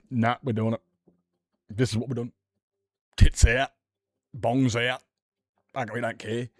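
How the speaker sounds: flaunt it like you own the screen; defiant swagger. Retold or imitated. Mimicking the show's blokes with cocky defiance.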